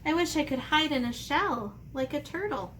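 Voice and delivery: ordinary delivery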